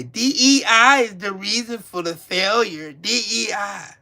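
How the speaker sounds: lightly put-on pundit voice, last words slowed; sneering impression of a TV talking head; mocking a Fox News pundit. A hint of mocking Trump's pals on Fox News. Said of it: "and say".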